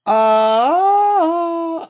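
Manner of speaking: high, loud alien squeal; alien noise. Shown as a demonstration. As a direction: screech like a creature from space. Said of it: "I'm trying to do an alien noise".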